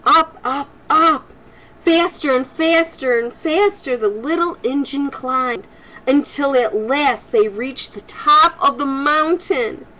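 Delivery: lively storybook read-aloud voice; reading to children. Acted. Grandma reading a storybook to kids, lively and warm. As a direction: read it brightly to the little ones.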